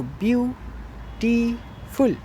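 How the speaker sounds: "ब्यूटीफुल" split slowly into byu, tee, ful; each syllable of "ब्यूटीफुल" held and separated. Shown as a demonstration. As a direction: count out three syllables in "ब्यूटीफुल". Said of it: "ये three syllables बने हुए हैं".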